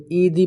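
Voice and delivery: "ایدی" with long ee and no h; missing ha. Shown as a demonstration. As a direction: say "ایدی" as ee-dee. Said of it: "ہا کو واضح کرنا ہے". ("ایدی" ہا کے بغیر)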